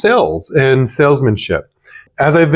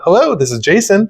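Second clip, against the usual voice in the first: much higher, bright phone hello; excited phone greeting; really excited; 8 semitones higher than usual. Shown as a demonstration. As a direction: switch on the cheer for the caller. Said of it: "really excited".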